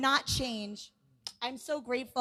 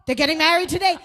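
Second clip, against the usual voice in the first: higher, louder excited gasp of realization; sudden realization; 6 semitones higher, 7 dB louder, faster than usual. Retold or imitated. Father-in-law finally realizing the wedding is today, excited. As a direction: it finally clicks, burst out. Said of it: "He's like".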